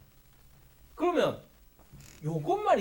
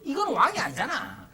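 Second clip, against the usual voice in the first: ordinary delivery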